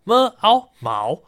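么, 凹 slowly blended into rising 毛; sounded-out syllable. Shown as a demonstration. Podcaster demonstrating a dictionary pronunciation, slow sounded-out syllables. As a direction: spell out 么 and 凹, then join them as 毛. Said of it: "它的发音呢，其实是这样的".

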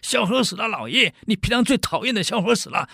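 high eager gloating announcement; excited servant. Acted. Servant telling master his rival died, eager and high. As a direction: push the good news on your master, pitched high.

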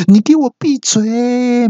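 put-on high, slow comic snap at the kid; mock angry shout. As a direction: bite off each word at the child. Father snaps at child. (爸爸慢慢尖声喝斥孩子闭嘴)

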